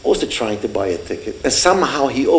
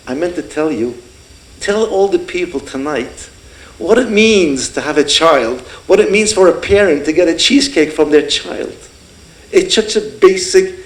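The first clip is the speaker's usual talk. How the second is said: father's passionate plea; 6 semitones higher than usual. Retold or imitated. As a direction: plead it with rising passion. Father's heartfelt speech retold.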